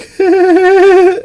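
high, loud giggle fit; imitated uncontrollable giggling. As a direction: mimic a kid who can't stop giggling. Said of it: "couldn't stop giggling".

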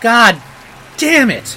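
sudden loud, high curse; frustrated outburst. Real, not acted. Viewer reacting to the Oscar winner with an angry outburst. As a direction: blurt out your disbelief.

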